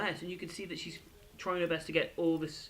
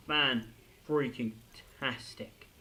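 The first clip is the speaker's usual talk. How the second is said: drawn-out emphasis; 5 semitones lower, much slower than usual. Real, not acted. Emphatic gushing praise.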